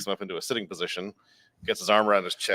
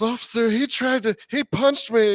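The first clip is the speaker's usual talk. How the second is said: very high, louder tattling voice; hammed-up victim complaint; mock aggrieved plea; 6 dB louder than usual. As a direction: imitate an outraged kid telling an officer. Kid pleads to cop, retold.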